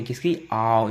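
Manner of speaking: ordinary talk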